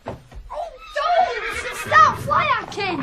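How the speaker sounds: high, loud panicked pleas; frightened cry. Acted. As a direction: beg him to stop hitting. Being attacked, crying out.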